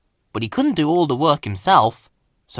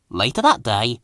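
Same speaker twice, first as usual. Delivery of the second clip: usual speaking voice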